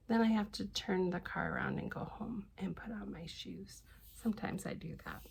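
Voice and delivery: confessing in a whisper. Real, not acted. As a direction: drop to a secret whisper. Whispered end of silly story.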